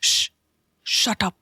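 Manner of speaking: short, controlled hush and order; slightly stern. A narrator's reading. Neel silences Meera with his hand, slightly stern. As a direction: shush firmly without raising the voice. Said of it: "नील थोड़ा सख्त लहजे में बोला".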